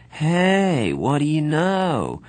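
put-on a drunk sneer, drawn out slowly; a loose tipsy taunt; lightly voiced drunk Soc; unsteady drunk. A narrator's reading. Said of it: "Bob said a little unsteadily".

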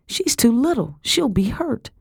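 high, anxious appeal; high-voiced, worried plea; pleading. A narrator's reading. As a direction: beg for a little sister. Girl pleads with Madam.

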